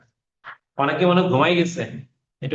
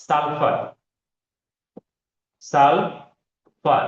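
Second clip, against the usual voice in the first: slow, syllable by syllable; drawn-out dictation; much slower than usual. Shown as a demonstration. Teacher dictating a term to students, slowly drawn out. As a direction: stretch the word out very slowly for note-taking. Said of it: "এগুলো কিন্তু জিজ্ঞেস করবো".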